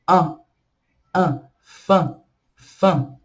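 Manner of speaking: an and fan as a nasal an; nasal vowel demo. Shown as a demonstration. Teacher demonstrating the nasal vowel, nasal an. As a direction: show the nasal sound in faim. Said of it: "o som A e M fica".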